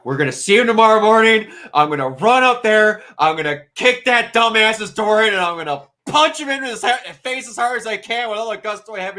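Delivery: Hodgins fired up. Acted. Hodgins boasting he'll punch his friend, loud fast rant. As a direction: charge in, bragging. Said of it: "Hodgins is like".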